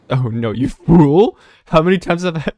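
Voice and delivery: loud, high mock outrage; mock scolding. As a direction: scold them loudly in pretend exasperation. Mock scolding to make viewers subscribe.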